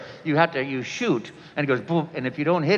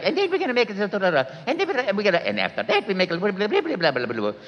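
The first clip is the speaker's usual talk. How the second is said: much higher Mario running out of words; 6 semitones higher, faster than usual. Acted. Still auditioning as Mario, high and running dry. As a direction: bounce through the nonsense syllables as Mario. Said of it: "I was like".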